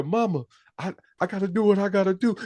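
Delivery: high mock-weary son; imitating the son. Retelling the son answering his mother, high put-on voice. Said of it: "It's like".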